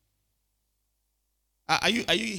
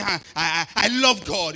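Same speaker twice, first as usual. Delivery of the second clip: mock bragging; 6 dB louder than usual. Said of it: "You are bragging".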